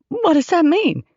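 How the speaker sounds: very high, alarmed question; voice rising in pitch. A narrator's reading. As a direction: ask it with your pitch shooting up. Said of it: "Her voice went up in pitch again".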